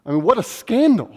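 usual speaking voice

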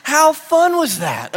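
hammed-up amazed disciple; excited disciple. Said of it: "looked at each other and went".

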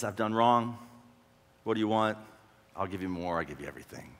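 low, fading surrender; defeatist. Acted. Hezekiah giving everything to Assyria, resigned and defeatist. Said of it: "It seems terribly defeatist".

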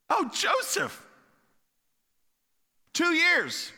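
high-pitched startled recall; sudden remembering. Acted. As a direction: it just hit him after two years. Cupbearer suddenly remembers Joseph.

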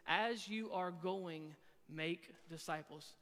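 slow right down, spacing out the phrases; slow, deliberate reading. Shown as a demonstration. Preacher giving students his English rendering of the verse, slow and deliberate.

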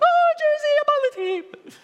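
high, loud squeal of delight; squealing excited fan; giddy excitement. Pretend. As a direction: gush like a thrilled kid getting his jersey. Excited player gets his jersey.

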